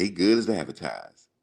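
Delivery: lightly imitated country accent; a country coach vouching for a player; Coach Bowden. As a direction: put on some country accent. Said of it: "he had the real country accent".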